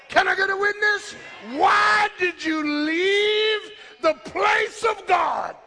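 high, sustained pulpit shout; full-force preaching. Real, not acted. Preacher warning congregation about leaving God's place, full-force preaching. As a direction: cry out to the congregation, stretching the climax.

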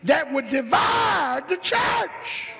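loud, high preaching cry; full-force preaching. Acted. Preacher warning the church, loud and high.

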